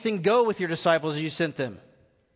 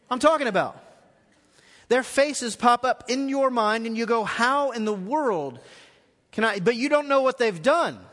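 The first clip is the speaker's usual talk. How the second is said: fervent preacher voice, louder and higher; preaching at full force; 5 semitones higher, 3 dB louder than usual. Acted. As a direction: drive the eternal debt home from the pulpit. Preaching to the congregation.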